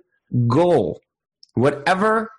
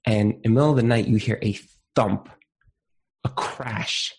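ordinary talk

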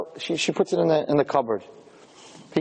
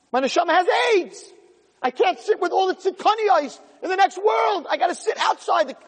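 anguished shout; 9 semitones higher than usual. Acted. The soul shouting at the Yetzer Hara in the next world, anguished high shouting. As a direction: cry out like a doomed soul.

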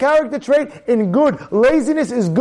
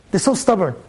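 ordinary talk, nothing marked

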